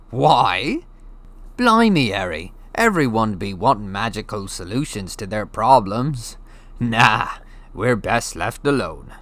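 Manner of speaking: put-on a giant speaking casually to a boy; Hagrid, big friendly giant. A narrator's reading. Hagrid explaining to Harry, casual giant voice.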